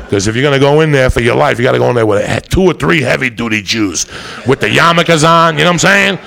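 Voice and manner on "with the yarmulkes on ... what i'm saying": higher, louder, building excitement; hyped-up riff. Real, not acted. Comic telling the crowd about tough lawyers, higher louder hype. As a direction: ramp it up, selling the bit.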